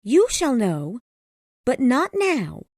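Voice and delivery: a hint of anxiety in Helen's voice; anxious. A narrator's reading. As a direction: hold him off, worried. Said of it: "she said anxiously".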